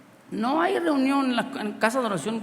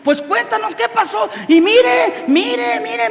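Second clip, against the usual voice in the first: much higher and louder, urgent; the healed sister shouting for everyone to look; excited shouting; 10 semitones higher, 9 dB louder, slower than usual. Retold or imitated. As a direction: shout it, insisting they look. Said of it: "y gritando".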